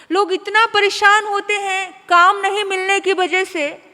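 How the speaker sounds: booming preacher's warning; forceful preaching. Acted. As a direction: drive the lesson home.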